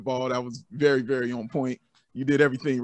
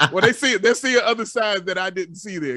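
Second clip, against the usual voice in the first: laughing; 7 semitones higher, 6 dB louder than usual. Real, not acted. Laughing at friends' doubt.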